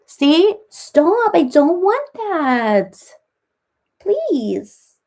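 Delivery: high, loud squeal of protest. Real, not acted. Fending off the cat's licks, high loud squeal. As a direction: push the cat off, laughing-squealing.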